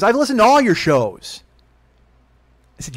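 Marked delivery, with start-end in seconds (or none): 0.0-1.4 high, loud imitation of mom; mock maternal accusation; reproachful mother. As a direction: push it up loud as mom confronting her son. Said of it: "She goes, she goes".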